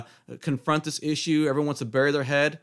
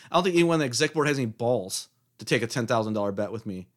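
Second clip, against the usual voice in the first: ordinary talk, nothing marked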